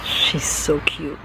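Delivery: soft, slow hushed aside; whisper. Real, not acted. Owner whispering to viewers about her cat. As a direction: whisper so the cat isn't startled.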